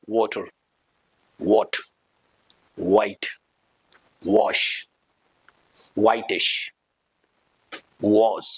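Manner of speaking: each word slow and low with lips rounded into an oval for w; rounded-lip drill words. Shown as a demonstration. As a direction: drill the w sound word by word, teacher demo. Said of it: "lips की shape को हल्का सा ओवल".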